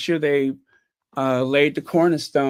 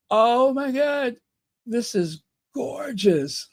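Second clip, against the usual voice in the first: amazed; 7 semitones higher, slower than usual. Real, not acted. Gasping at house photos.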